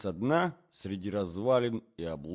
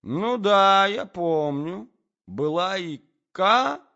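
high, loud, halting recollection; absent-minded prince; 9 semitones higher, 10 dB louder than usual. A narrator's reading. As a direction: recall it vacantly, stretching the words. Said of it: "пробормотал бессознательно князь".

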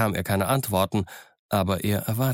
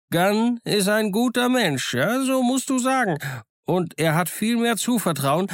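higher, lively voice of a half-wild marooned sailor; higher-pitched, eager old castaway; eager castaway Ben Gunn; 10 semitones higher than usual. Acted. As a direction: play the odd, chattering island hermit, pleased with himself. Ben Gunn's main message.

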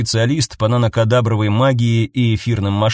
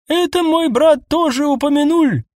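too high elf voice. Acted. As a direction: speak in an unnaturally high voice. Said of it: "сказал эльф слишком высоким голосом".

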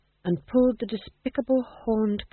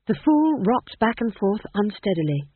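usual speaking voice

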